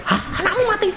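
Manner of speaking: high, startled gasp of a question; startled preacher. Retold or imitated. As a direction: sound caught off guard. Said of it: "pendeta dia kaget". (pendeta kaget, suara tinggi)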